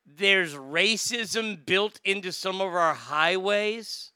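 slow, incredulous echo of the quote; baffled repeat. Retold or imitated. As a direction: draw it out as a disbelieving question. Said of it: "What the fuck does that mean?".

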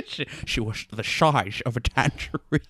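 put-on slushy-s Scottish accent; Sean Connery. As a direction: turn every s to sh, mock Scottish. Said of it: "Your Sean Connery's pretty good".

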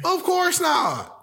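high, slow, sneering "of course not"; sarcastic drawn-out. Acted. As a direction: stretch it out with heavy sarcasm. Mocking Kyrie's insincere apology.

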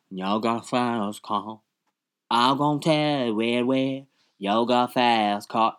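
mock mumbling cover singer, slurred "You got a fast car"; mock bad singer. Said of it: "did a cover and was like".

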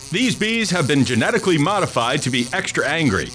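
high, fast-talking infomercial announcer. Acted. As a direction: hype it like a late-night infomercial. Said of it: "a word from our sponsors".

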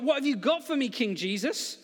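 bursting to get started on the job; excited. Acted. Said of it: "He's excited. He's like".

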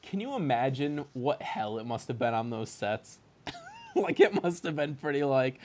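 usual speaking voice

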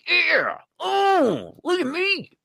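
very high mock-preening voice; smug self-praise. Himself after the trim fit, in a high mock-preening voice. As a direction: prance about the proud moment. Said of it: "I'm like".

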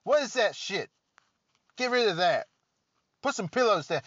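a hammed-up swaggering character taking over.

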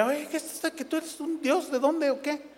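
high, soft, quick puzzled voice; imagined apostles' exchange. Acted. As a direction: play a baffled apostle quietly asking his companion. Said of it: "yo me imagino a Pablo y a Bernabé". (voz aguda y baja de apóstol desconcertado)

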